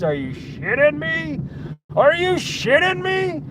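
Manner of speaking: are you kidding me, shout it high; incredulous yell. Real, not acted. Outraged rant.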